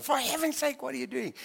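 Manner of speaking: high, exasperated mock scold from a mother; a hint of an aggressive mother's scold. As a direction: pitch it up like an exasperated mum telling off a child. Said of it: "quite aggressively".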